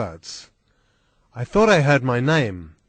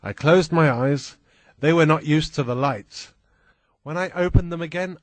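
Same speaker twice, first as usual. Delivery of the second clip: plain reading voice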